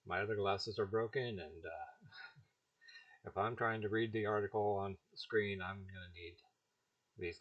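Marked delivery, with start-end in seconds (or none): none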